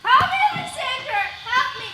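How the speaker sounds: high, panicked plea for help; frightened cry. Acted. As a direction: cry out, waking from a nightmare. Waking from a nightmare, calling.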